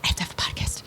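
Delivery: hushed, whispered confession; awed whisper. Retold or imitated. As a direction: whisper it as a secret realization. Retold private realization.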